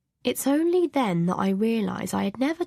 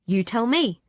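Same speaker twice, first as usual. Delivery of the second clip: angry; 3 semitones higher, 4 dB louder than usual. A narrator's reading. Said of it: "I turn on him angrily".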